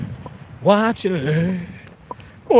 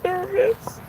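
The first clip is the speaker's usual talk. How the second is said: mock scared squeal; 11 semitones higher, slower than usual. High frightened squeal.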